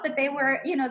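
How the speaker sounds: ordinary delivery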